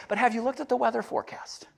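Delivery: high, fast whisper; whispered Jesus aside. Retold or imitated. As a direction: lean in and whisper it like a secret tip. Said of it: "And Jesus is like".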